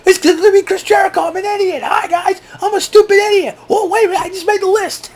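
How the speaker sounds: shrill falsetto mock idiot; goofy high voice. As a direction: squeal it like a silly cartoon. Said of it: "I go".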